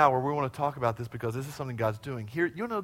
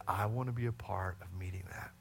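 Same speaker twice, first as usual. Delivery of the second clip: whisper; 11 semitones lower, 15 dB quieter than usual. Retold or imitated. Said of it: "Ornan said".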